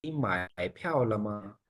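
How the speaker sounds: slow, each syllable drawn out; slow pronunciation model. Shown as a demonstration. Teacher has class repeat the date, slow pronunciation model. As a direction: say it slowly for students to copy. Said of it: "pronounce कीजिएगा".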